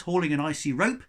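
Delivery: plain reading voice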